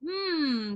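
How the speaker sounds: sustained resonant "mmm" hum; humming on M. Shown as a demonstration. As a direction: hold a hum on the M. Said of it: "I like the M of meow".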